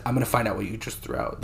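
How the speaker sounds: ordinary talk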